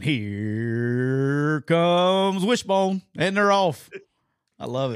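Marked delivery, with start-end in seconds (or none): 0.0-3.0 slow, drawn-out track announcer call; race announcer. Retold or imitated. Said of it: "You up there going". 3.2-3.9 high and loud, they're off; excited race call. Retold or imitated. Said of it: "You up there going".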